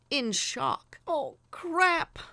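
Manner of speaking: high, loud shocked curse; shocked outburst. Acted. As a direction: blurt it out in sudden shock. Shock after the crash.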